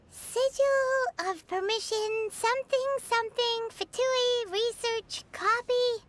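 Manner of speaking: drawn-out, level sing-song reading; halting read-out. Acted. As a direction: read haltingly, skimming a hard-to-read note. Reads out scrawled paper haltingly.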